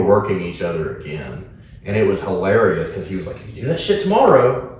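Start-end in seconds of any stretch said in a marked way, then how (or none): 3.4-4.7 higher-pitched Aussie voice, retelling a threat; put-on Australian twang; Aussie accent. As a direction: imitate an Aussie wrestler, pitched up. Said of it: "got, like, an Aussie".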